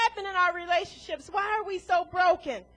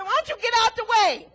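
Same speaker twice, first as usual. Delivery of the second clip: mock yell at drivers; 4 semitones higher, 5 dB louder than usual. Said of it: "We yelled at those Sunday drivers".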